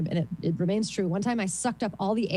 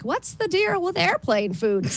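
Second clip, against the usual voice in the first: high, slow classic comic bit; stand-up comic voice; 10 semitones higher, 4 dB louder, slower than usual. Retold or imitated. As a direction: ham up the old airplane-food joke.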